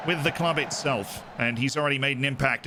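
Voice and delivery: ordinary talk, nothing marked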